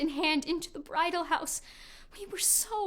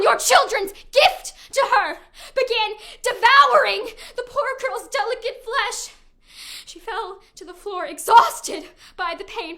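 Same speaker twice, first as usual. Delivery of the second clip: loud, high, horrified telling; horrified outcry; 4 semitones higher, 7 dB louder than usual. Acted. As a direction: shout the horror out. Graphic death described in horror.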